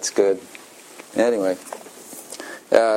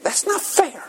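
put-on kid protesting. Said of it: "because they think".

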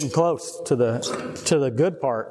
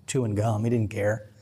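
ordinary delivery

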